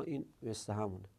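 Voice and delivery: ordinary delivery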